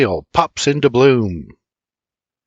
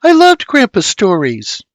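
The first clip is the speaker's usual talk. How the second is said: put-on higher, louder girl voice, firm; girl voice declaring; 10 semitones higher, 6 dB louder than usual. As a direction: declare it like a sure little girl.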